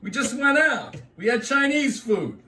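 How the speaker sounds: high, exasperated complaint; annoyed New Yorker. Retold or imitated. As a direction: argue against going out again.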